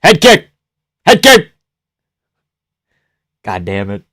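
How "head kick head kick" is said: quick high, loud shouts; excited calling out. Real, not acted. Fan calling for a kick, excited shout. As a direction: yell the strike to your fighter.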